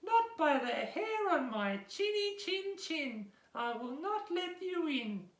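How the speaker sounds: little pig refusing the wolf; squeaky little pig. Acted. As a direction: squeak it out defiantly. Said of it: "the little pig said".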